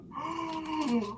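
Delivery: high crying gasp; tearful gasp. Real, not acted. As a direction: gasp through tears. Overwhelmed opening a gift.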